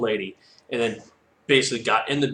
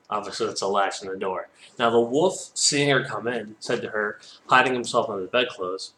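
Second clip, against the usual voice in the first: ordinary delivery, nothing marked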